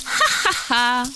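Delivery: breathy high snicker; a sly weasel's snigger; voiceless mocking ha ha ha; sneering snicker. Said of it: "ricane-t-elle".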